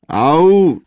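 loud, swooping howl of pain; big yelped ouch; pained roar. A narrator's reading. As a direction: bellow it as if trodden on. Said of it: "zařval bubeník".

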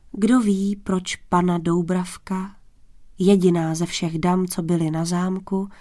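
ordinary delivery, nothing marked

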